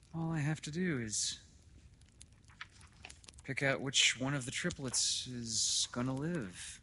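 a hint of a miserable father, fading at the end; wretched father. Father explaining his grim choice to doctor, understated misery. Welling, a figure in the story.

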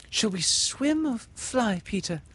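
put-on high, light young girl; girl's voice. Windy asking Peter how to escape, high girl. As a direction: ask it innocently.

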